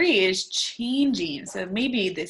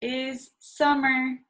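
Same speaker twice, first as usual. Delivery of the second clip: slow model phrase; 3 semitones higher than usual. Shown as a demonstration. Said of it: "let's touch".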